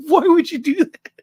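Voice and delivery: very high, loud mock outrage; hammed-up shocked scolding; horrified parents. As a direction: squeal it like an appalled parent. Horrified parents, laughing retelling.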